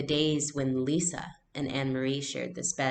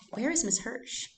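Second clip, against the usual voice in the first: mysterious question; 9 semitones higher than usual. Shown as a demonstration. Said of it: "chapter title posed as question".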